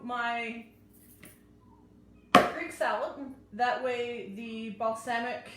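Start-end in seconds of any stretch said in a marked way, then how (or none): none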